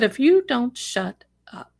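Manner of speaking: a hint of the cop's emphatic warning; stern cop. Cop telling the screaming man off, mildly mimicked stern warning. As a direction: repeat the officer's order, lightly imitated. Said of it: "I don't talk like that, but the cop did".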